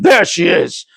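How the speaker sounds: plain reading voice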